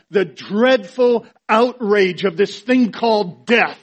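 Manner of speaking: loud, high, driving sermon voice; impassioned preaching. Real, not acted. Preacher on Jesus pausing before raising Lazarus, impassioned.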